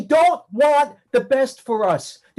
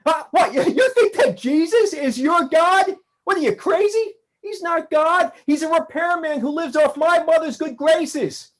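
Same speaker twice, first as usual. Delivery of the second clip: mocking rage; 4 semitones higher than usual. [name] jeering at the aliens, full of scornful fury. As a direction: sneer at them with scornful fury.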